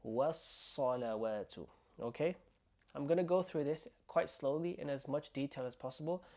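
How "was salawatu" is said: was-salawatu slow, with a long held S; doubled S in was-salawatu. Shown as a demonstration. Teacher showing two written letters, long S in was-salawatu. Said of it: "that's how you do it".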